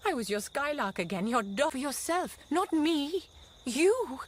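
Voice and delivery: building to a loud, high "You!"; angry accusation. Acted. Wife accuses her husband of selfishness, rising to a loud outburst.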